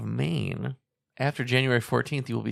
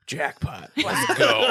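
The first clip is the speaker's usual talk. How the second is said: gleeful; 10 semitones higher, 5 dB louder, much slower than usual. Pretend. Voicing gleeful pregnant women.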